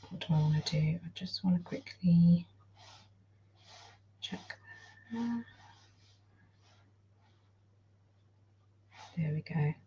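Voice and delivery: very soft, half-whispered self-talk; muttering to self. Real, not acted. Streamer checking the screen, whispering to herself. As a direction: murmur it under your breath, barely voiced.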